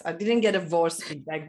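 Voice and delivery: ordinary delivery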